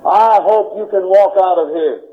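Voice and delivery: normal talking voice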